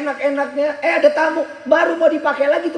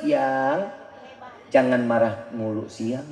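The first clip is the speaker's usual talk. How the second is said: soft coaxing wife; 13 semitones lower, 7 dB quieter, slower than usual. Retold or imitated. Wife soothing angry husband.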